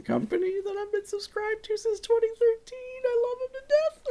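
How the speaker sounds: squeaky falsetto ad read; very high voice. Acted. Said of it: "when you go that high".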